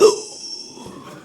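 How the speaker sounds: breathy mock gasp of shock. Classmates shocked by new African girl, mock gasp.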